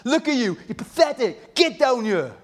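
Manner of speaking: high, sneering mock taunts; voicing the mocking crowd; taunting crowd. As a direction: jeer at the man on the cross. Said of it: "when they were taunting him, saying".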